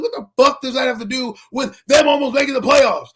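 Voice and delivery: indignant shout. Retold or imitated. Fan shouting down a Cowboys friend, indignant. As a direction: fire it back in disbelief. Retorting to Cowboy fan's jab.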